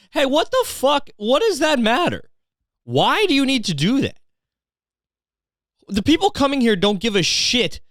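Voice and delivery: very high-pitched, incredulous questioning; comically outraged disbelief; exasperated rant. Acted. Comic rant at bar's sign.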